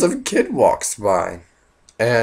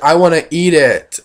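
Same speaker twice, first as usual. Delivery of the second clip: put-on higher, louder whiny kid voice; little kid; 6 semitones higher, 8 dB louder than usual. Kid asking mom to eat air freshener, loud childish voice. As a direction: beg like a small kid. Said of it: "he's like".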